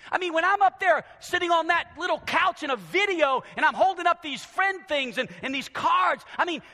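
high, loud, impassioned preacher; fired-up pulpit delivery; full-force preaching. Real, not acted. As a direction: build to a shout for the congregation.

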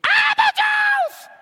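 put-on very high, loud child's yell; girl yelling for apple juice. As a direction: scream for the juice like an excited girl. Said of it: "and she screams".

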